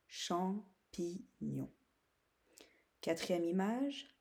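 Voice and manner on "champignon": "champignon" slow, broken into three; syllable by syllable. Shown as a demonstration. Teacher letting pupils locate the sound, syllable by syllable. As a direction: sound out "cham, pi, gnon" slowly. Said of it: "deuxième ou troisième syllabe".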